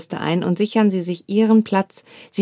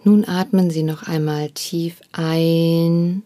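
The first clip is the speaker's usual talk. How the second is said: guided meditation; 3 semitones lower than usual. Acted. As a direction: ease them back gently. Leads a meditation.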